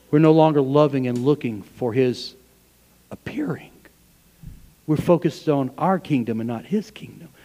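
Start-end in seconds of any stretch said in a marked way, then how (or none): 3.1-3.7 whispered appearing; hushed whisper. Acted. Pastor on waiting for Christ, whispered. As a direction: drop to a whisper on the last word. Hushed word at sentence end.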